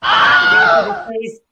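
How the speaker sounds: high, loud shouted announcement. Acted. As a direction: belt it out like a show host. Announcing a gift loudly.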